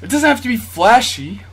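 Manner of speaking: usual speaking voice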